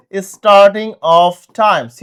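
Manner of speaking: starting of time, each word drawn out slowly; slow drilled phrase. Shown as a demonstration. Teacher drilling a key phrase, very slow. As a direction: spell it out slowly for the class. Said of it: "কি ব্যবহার করব?".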